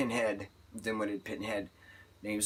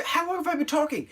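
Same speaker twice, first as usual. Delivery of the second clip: high, startled question to herself; surprised self-aside. Real, not acted. Vlogger noticing she's rambled on, high and surprised. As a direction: suddenly realize the time.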